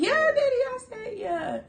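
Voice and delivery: high, happy squeal; gushing. Retold or imitated. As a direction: reassure him in delight. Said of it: "I'm like".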